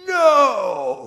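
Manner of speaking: loud, high drawn-out mock scream; child's scream. Four-year-old son refusing dad at the airport, screaming. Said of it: "just screams at me".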